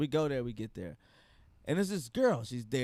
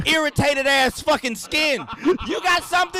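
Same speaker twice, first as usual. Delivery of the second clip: mocking roast; 7 semitones higher, 5 dB louder than usual. Real, not acted. Roasting his friend mid-argument.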